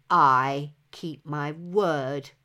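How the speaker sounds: low, very slow growl; defiant hero growling at the giant. A narrator's reading. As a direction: bite out each word with defiance. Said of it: "growled the young hero".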